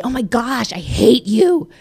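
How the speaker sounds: put-on half-hearted replay of a teen outburst; confessing an old habit to husband; mock juvenile outburst. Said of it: "there were times when I would say".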